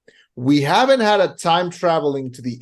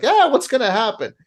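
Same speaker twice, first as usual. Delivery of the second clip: carefree shrug; 9 semitones higher than usual. Retold or imitated. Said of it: "It's like".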